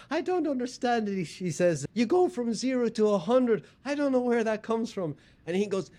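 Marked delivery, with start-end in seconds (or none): 0.1-1.2 wife voice pitched much higher, mock-plaintive; Bill Burr doing his wife. Comic retelling Bill Burr's bit, imitating his wife, high and plaintive. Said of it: "you can hear Bill doing his wife". 1.9-5.2 wife voice pitched much higher, mock-plaintive; Bill Burr doing his wife. Comic retelling Bill Burr's bit, imitating his wife, high and plaintive. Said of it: "you can hear Bill doing his wife".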